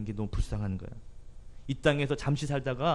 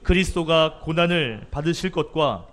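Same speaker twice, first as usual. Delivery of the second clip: slow, loud, raised solemn reading voice; scripture reading; 4 semitones higher, 6 dB louder, slower than usual. Acted. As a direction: proclaim the verse slowly and loudly. Reads scripture aloud before sermon.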